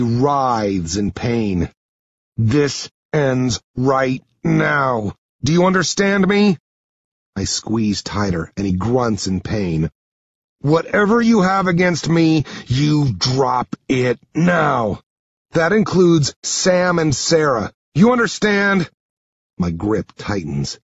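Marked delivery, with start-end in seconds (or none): 2.4-6.6 slow, separated words rising into a demand; forceful, drawn-out warning then sharp question; stern ultimatum. Acted. Hero threatens pinned bully. 10.6-18.9 raised, hard-edged warning; intense, higher-pitched ultimatum; forceful threat. Acted. Hero threatens pinned bully.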